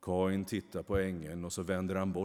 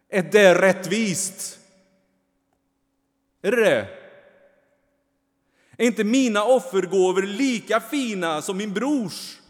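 high, loud angry challenge; indignant demand; 12 semitones higher, 14 dB louder than usual. A narrator's reading. Kain angrily demands justice from the angel. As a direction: throw the question at him.

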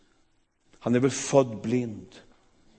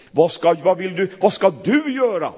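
loud, pressing demand; 5 semitones higher, 7 dB louder than usual. Shown as a demonstration. Preacher voicing the wrong, demanding Jesus, louder. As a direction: push the question hard, louder. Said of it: "Det sa han inte".

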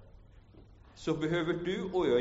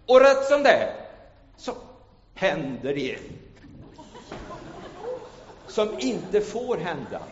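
sudden high, loud turn in the story; dramatic build; 8 semitones higher, 8 dB louder than usual. Acted. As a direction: jolt the listeners with sudden drama.

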